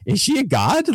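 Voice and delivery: high-pitched mock bewilderment; freaking out. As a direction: act out stunned disbelief. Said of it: "they're just freaking out".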